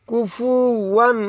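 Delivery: কুফুয়ান drawn out slowly, rising at the end; slow recitation. Shown as a demonstration. Teacher repeating the word slowly. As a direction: stretch কুফুয়ান gently. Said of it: "উচ্চারণ দিয়ে পড়ব".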